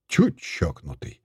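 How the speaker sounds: low, slow, good-natured grumble; without malice. A narrator's reading. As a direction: remark it mildly, no bite at all. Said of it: "беззлобно сказал около меня".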